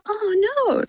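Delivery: high-pitched imitation of a let-down little kid; disappointed child. Mum imitating her daughter looking out, disappointed child. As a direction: imitate a small child missing out. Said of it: "she'll look at it and go".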